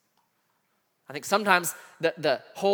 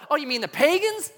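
questioning mom; 8 semitones higher than usual. Retold or imitated. Mom on phone guessing who, high questioning voice.

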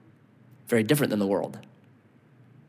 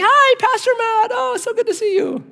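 gushing bank teller; 3 dB louder than usual. Retold or imitated. Bank teller greeting her pastor at the counter, high and gushing. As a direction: coo it sweetly like a delighted fan. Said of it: "and she's like".

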